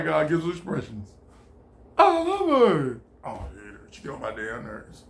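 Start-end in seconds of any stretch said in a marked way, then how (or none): 2.0-3.0 mock lovestruck. Voicing husband's moods. 3.2-5.0 low, much softer mock grumble; grumbling under breath. Voicing annoyed husband.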